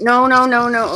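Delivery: loud alarmed no; alarmed shout. Real, not acted. Pet tries to get away.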